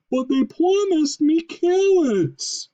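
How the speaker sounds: very high, loud, slow plaintive moose. Acted. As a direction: whine about the carrots.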